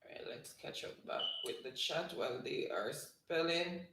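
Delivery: hushed aside so the spellers can focus; whisper. Real, not acted. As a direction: whisper it so you don't disturb them. Whispering while contestants spell.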